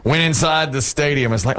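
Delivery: normal talking voice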